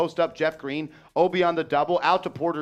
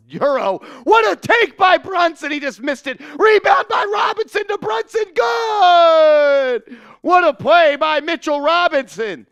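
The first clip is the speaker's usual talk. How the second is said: much higher and louder, a rising roar of play-by-play; excited shout; 13 semitones higher, 10 dB louder than usual. Real, not acted. Commentator calling a big Knicks play, an excited shout. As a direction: go off like the arena just exploded.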